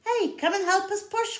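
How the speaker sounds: high, loud call for help; crying out. Acted. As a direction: call out to the bear to push. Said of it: "cried the raccoon".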